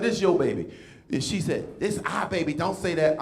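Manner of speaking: ordinary talk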